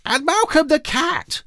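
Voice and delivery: very high, slow delighted call; thrilled to see the cat; girl greeting the cat, high and pleased. A narrator's reading.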